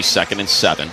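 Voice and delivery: normal talking voice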